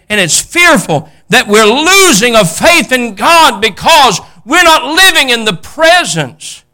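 loud, high, drawn-out preaching cry; slow, impassioned pulpit shout; full-force preaching. Real, not acted. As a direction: preach it urgently at the top of the voice.

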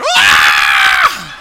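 loud, low startled scream; shocked scream. Retold or imitated. Boss's wife hearing about his cut foot, loud shocked scream. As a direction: mimic her shriek of shock, full and loud. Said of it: "And she went".

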